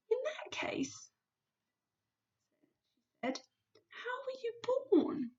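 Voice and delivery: put-on high, hushed girl's voice; little girl whisper. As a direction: ask softly like a small girl. Sophie asks softly.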